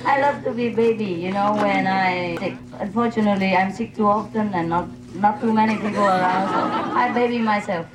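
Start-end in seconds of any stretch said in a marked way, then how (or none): none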